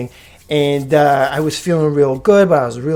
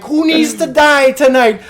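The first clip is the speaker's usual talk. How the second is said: high mock-furious yell; acted-out wild challenge; enraged boss; 11 semitones higher, 4 dB louder than usual. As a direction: holler the threat high-pitched. Boss shouting threat after shots.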